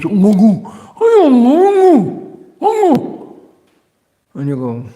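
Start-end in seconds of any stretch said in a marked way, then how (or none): 0.0-3.4 high, loud, slow mock babble in a put-on voice; strange man's babble. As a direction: ham up an odd stranger's gibberish, pitched way up. Horrible-looking man on Sankirtan.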